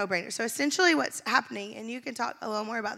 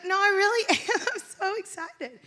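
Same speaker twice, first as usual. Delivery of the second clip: high-pitched, laughing protest; high laughing insistence that she means it; insistent excited; 9 semitones higher, 3 dB louder than usual. Retold or imitated. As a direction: insist you really are excited, laughing. Insisting to doubtful friend.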